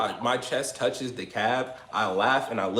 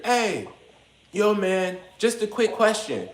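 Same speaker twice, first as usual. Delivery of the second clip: much higher, casual hailing voice; imitating a kid calling out; 9 semitones higher than usual. Kid walking up to ask who threw the punch, high imitated call. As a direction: voice the approaching kid, light and high.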